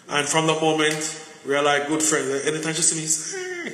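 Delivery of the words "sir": much higher, bright sing-song "Sir,"; lady's greeting. Retold or imitated. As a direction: call "Sir," up high like a cheerful coworker. The lady greeting him every time.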